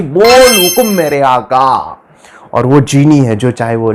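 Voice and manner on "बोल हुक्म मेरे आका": slow, drawn-out genie proclamation; genie's voice. Acted. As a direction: stretch the words like a summoned genie. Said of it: "जीनी प्रकट होता है और वो कहता है कि". (जिन्न की खिंची हुई आवाज़)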